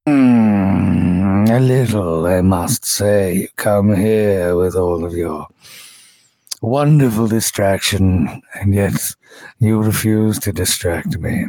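low, slow purring seductive voice; breathy vintage purr. Acted. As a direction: purr each word like a silky villain. GM voices Zul Grubub.

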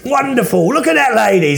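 loud, high market trader's call; street hawker. Pretend. Hawker touting a friend's arse to passers-by, mock market cry. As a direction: holler to the crowd like a barker.

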